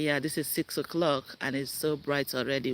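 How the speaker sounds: normal talking voice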